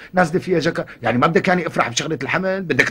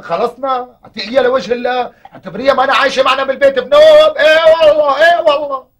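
louder, higher exasperated plea; exasperated pleading; 8 semitones higher, 12 dB louder than usual. Acted. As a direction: push her hard to give in. Husband pleads loudly with sister.

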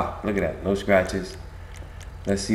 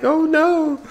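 mock victim cry. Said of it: "and they be like".

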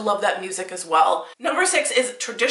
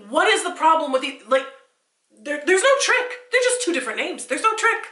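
higher, insistent no trick; exasperated protest; 7 semitones higher than usual. Real, not acted. To viewers, protesting the silly list, exasperated. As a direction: plead that it's obvious.

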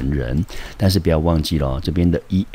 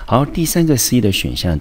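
ordinary delivery

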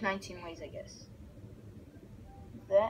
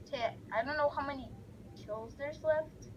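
ordinary talk

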